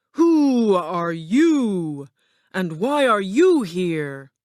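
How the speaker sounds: slow, drawn-out imperious demand; roaring wizard. A narrator's reading. Ball of fire questions the lion, slow roar. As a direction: challenge the visitor with a long roaring "who". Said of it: "the ball of fire roared".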